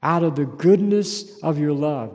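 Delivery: loud, slow psalm reading; preacher reading a psalm aloud; fervent scripture reading. Acted. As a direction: proclaim the verse.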